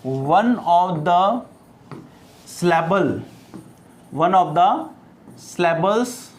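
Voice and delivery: slow, spaced-out dictation reading. Real, not acted. Teacher dictates a definition to students, slow dictation. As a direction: dictate word by word with long pauses.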